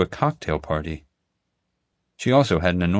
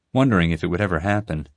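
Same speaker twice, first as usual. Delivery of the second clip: ordinary delivery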